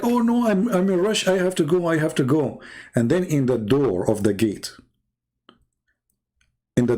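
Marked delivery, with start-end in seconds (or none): 0.0-2.6 lightly voiced guest pretending to rush off; higher-pitched mock protest, easing down as it repeats; imitating hurried guest. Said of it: "I would do what the Middle Eastern do".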